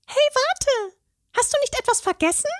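very high fairy call, fast; calling after him. A narrator's reading. Fairy calls after the troll, very high and quick. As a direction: shout after the departing troll. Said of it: "rief Steffi ihm nach".

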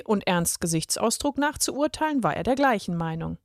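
ordinary delivery, nothing marked

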